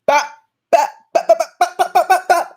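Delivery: high, loud mock gunshot bops; gunshot sounds. Rapper mock-shooting a hater with mouth gunshots. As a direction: fire off quick bop bop gunshots with the mouth. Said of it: "I just shot you".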